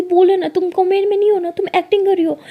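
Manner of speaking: high, loud insistent shouting. Retold or imitated. Lakshmi demanding Lily admit the act, loud shout. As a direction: yell it at her to confess. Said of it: "ज़ोर ज़ोर से चिल्लाती है".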